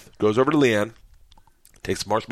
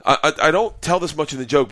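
ordinary delivery

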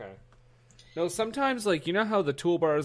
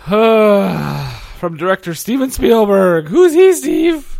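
loud, high, drawn-out mock trailer shout; hammed-up film announcement; 7 semitones higher, 12 dB louder, much slower than usual. Host mock-announcing a film director, hammed-up shout. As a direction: belt out a slow, over-the-top movie credit.

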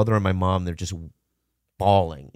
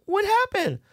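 put-on kid voice. Kid asking about cat.

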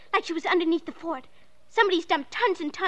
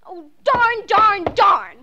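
frustrated; 2 semitones higher, 7 dB louder, much slower than usual. Acted. Girl frustrated digging alone.

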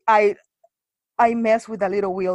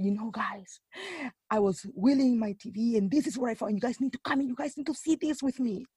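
very soft, conspiratorial retelling; hushed secret; 2 semitones higher, 9 dB quieter than usual. Retold or imitated. Telling childhood friends about the TV find, hushed. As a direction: let friends in on it under your breath. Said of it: "what am I whispering".

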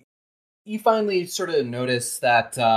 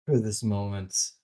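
hushed reply; 5 semitones lower, 7 dB quieter, faster than usual. Acted. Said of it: "she's just like".

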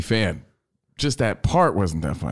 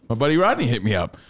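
normal talking voice

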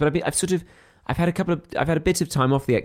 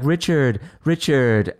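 lightly voiced Hyacinth Bucket calling her husband; a hint of the snobbish sitcom wife summoning Richard; 3 semitones higher, 3 dB louder, much slower than usual. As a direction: call Richard in a prim, mildly posh sitcom voice. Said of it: "she's always going like".

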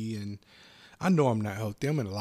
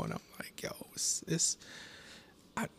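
ordinary talk, nothing marked

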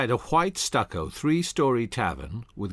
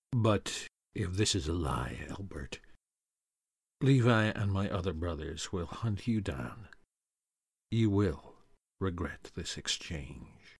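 low, softer threat slowing at the end; quiet threat; 3 semitones lower, 6 dB quieter than usual. Acted. Doan warning Humphreys, low quiet threat. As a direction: threaten him under your breath.

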